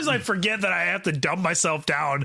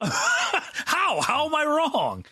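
indignant; 10 semitones higher, much slower than usual. Real, not acted. Indignant challenge.

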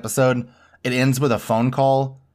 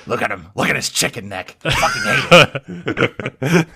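mock yelling; 2 semitones higher, 4 dB louder than usual. Podcaster imitating a ranting TV host, mild mock yell. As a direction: ham up the angry yell, stretching fucking hate. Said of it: "Them yelling".

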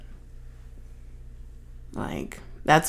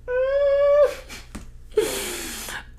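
high squealing laugh. Real, not acted. Reacting to Simon Birch memory.

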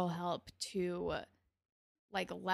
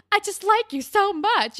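much higher, much louder swooning squeal; gushing lovesick whine; 13 semitones higher, 13 dB louder than usual. Retold or imitated. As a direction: squeal it like a smitten teen. Terry gushing over Rick, imitated.

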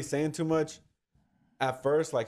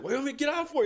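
very high, silly protest; mock-whiny high question; goofy friend impression; 14 semitones higher, much faster than usual. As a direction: imitate a bewildered goofball, pitched way up.

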